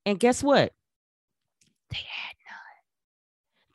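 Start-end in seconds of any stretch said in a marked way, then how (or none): 1.9-3.7 "They had none." whispered slowly, no voice. Real, not acted. Same punchline said again whispered.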